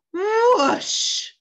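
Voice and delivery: high, loud whooshing noise. A narrator's reading. A plane suddenly shooting out of cloud, whoosh. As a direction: imitate a plane rushing past, loudly.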